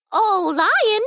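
very high, squeaky little voice; squeaky mouse. A narrator's reading. Tiny mouse calling to the lion, squeaky. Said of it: "a squeaky voice said".

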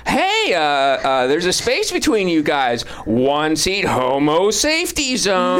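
high nasal-free comic drawl, pitched well up; hack comic voice. Retold or imitated. Comedian recalls a bitter hack comic, imitating his voice high. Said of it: "he talked like this".

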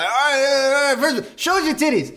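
high, hurried emcee patter; hype man. Retold or imitated. Emcee starting down the line, high and hyped. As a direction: rattle it off like a stage host. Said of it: "he's like".

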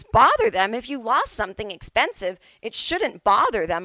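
retold incredulous comeback, loud on the first words; indignant retort. Attendant acted out, indignant retort.

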